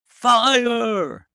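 high, loud cry of fire; yelled. A narrator's reading. Holmes urging the men to cry out, loud shout. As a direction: shout the alarm at full force. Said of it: "The shout must have rung over Norwood".

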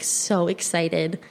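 usual speaking voice

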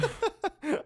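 high-pitched evil cackle; high villain cackle. Acted.

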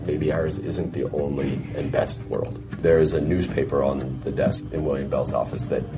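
usual speaking voice

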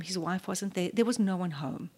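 usual speaking voice

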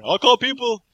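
a scared guy bluffing to buy time; panicky bluster. Acted. Mocking a cornered bluffer, blurting a loud panicky threat.

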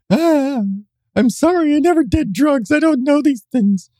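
high, sweet feigned innocence; mock innocent voice. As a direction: play dumb in a high voice.

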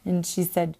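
ordinary talk, nothing marked

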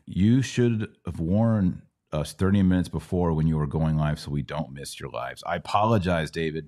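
normal talking voice

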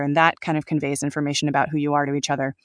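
normal talking voice

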